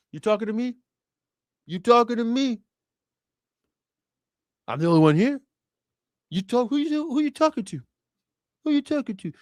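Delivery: high-pitched confrontational tough guy; Robert De Niro. Acted. Comic imitating a film star's confrontation, mock tough guy. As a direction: challenge someone as a famous New York actor. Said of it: "De Niro always is like".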